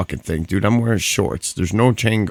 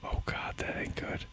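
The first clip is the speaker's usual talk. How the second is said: hushed worried whisper; frantic whisper; 17 dB quieter than usual. Real, not acted. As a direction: whisper in alarm. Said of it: "Why are we whispering?".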